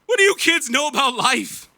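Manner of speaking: high, put-on voice of a shouting drunk; imitated yell from across the tracks; mock drunk yell. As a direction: throw it back like a bitter old drunk. Drunk men yelling at kids.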